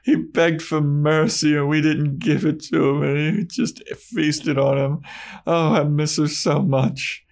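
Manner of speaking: high, wistful put-on Spike voice; reminiscent Spike. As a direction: sigh it out like a nostalgic vampire. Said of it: "he gets all reminiscent".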